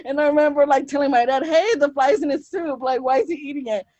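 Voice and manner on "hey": ordinary talk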